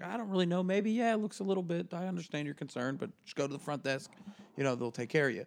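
smooth the customer over politely; mock chipper staff voice; polite customer service. Polite advice to complaining customer.

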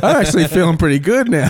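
high, loud laughing talk; giggling through the words. Real, not acted. Friends joking about a fun movie, laughing as she speaks.

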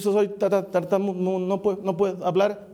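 stammering excuse, tripping on syllables; acted stutter, stuck on the first sounds; Moses. Reluctant prophet telling God he cannot speak, stammering. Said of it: "Cuando Moisés va delante del Señor y le dice".

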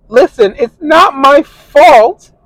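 high, slow whiny mock of the girlfriend; mocking his girlfriend's high, slow protest. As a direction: mimic her, high and drawn out. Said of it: "And she's like".

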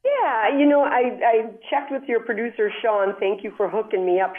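normal talking voice

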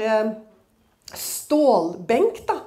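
usual speaking voice

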